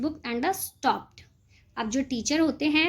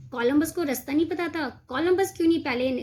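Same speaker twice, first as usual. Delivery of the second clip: usual speaking voice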